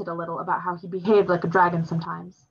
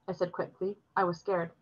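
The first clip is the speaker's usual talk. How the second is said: usual speaking voice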